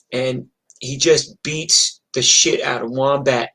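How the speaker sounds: plain reading voice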